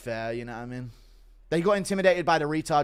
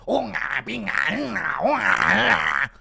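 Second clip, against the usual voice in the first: mock chief gibberish; 5 semitones higher, 6 dB louder, slower than usual. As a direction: babble like a clueless chief.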